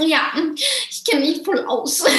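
high, drawn-out words breaking into laughter; mock the know-it-all and crack up; mocking, laughing.

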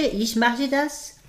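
put-on slow, high German with a French accent. Actress demonstrating her French accent to the interviewer, French accent slow and high. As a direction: show off her French stage accent, slowly. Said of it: "Willst du hören, die französische Sprache?".

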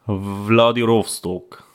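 put-on slow Russian-accented Wladiwostok; drawn-out, heavy Russian accent; russian accent in german. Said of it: "mit einem russischen Akzent".

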